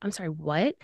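low, soft, questioning disbelief; retold confusion. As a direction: quietly ask in mock bewilderment. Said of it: "I'm just confused".

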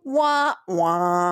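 sung falling wah wah; sad trombone wah. Retold or imitated. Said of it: "it would go".

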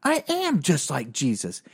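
high, exalted epiphany voice. Retold or imitated. Guy announcing to his friend he is like Jesus, exalted and high. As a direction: proclaim it like a revelation. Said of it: "this guy's going".

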